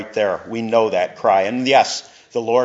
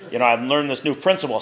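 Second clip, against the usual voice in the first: usual speaking voice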